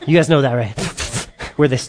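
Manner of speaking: ordinary talk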